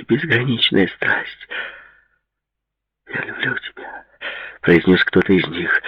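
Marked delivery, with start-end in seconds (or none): 3.1-4.1 very soft, voiceless murmur; whispered love confession. A narrator's reading. One lover confesses love to the other, whispering. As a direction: whisper it intimately.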